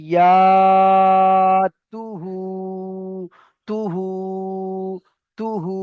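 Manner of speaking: ইয়া, তুহু with each vowel stretched long; drawn-out vowels. Shown as a demonstration. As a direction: pull out the vowel of তুহু slowly. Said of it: "খেয়াল করে দেখেন আমি কেমন ভাবে টান দিচ্ছি". (টেনে টেনে লম্বা করে উচ্চারণ)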